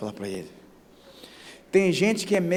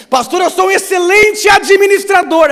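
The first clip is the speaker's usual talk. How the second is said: high, loud mock boast; boastful member; 15 dB louder than usual. Church member bragging to the pastor, loud mock pride. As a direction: puff up like a proud administrator. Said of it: "boastful church member".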